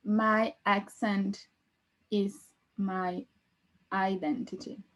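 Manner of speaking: very slow, broken into short chunks; slow dictation. Shown as a demonstration. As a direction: dictate it word by word with long pauses. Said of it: "E eu vou escrever isso aqui".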